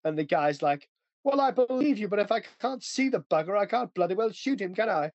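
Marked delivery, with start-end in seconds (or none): 1.2-5.1 higher-pitched mock British officer; British tank commander. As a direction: imitate a stubborn British officer in an old war film. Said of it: "British tank rolls up".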